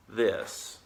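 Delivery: ordinary delivery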